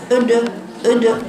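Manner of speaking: أد with rounded lips, a u tint on the dal; wrong rounded dal. Shown as a demonstration. Teacher shows the wrong, rounded أد. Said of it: "مفيش كده أبدًا".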